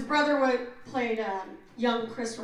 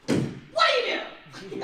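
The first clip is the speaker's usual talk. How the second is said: high, loud, hammed-up detective demand; a loud, overblown TV cop grilling a kid; Mariska Hargitay impression; 7 dB louder, slower than usual. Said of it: "tried to do my best Mariska Hargitay".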